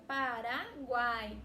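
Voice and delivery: Paraguai said very slowly, vowels run together; teacher modeling a triphthong word; slow demo. Shown as a demonstration. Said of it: "tudo junto".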